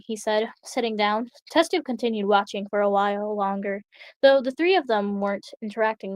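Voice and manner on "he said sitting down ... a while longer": plain reading voice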